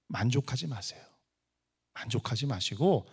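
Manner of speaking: ordinary talk, nothing marked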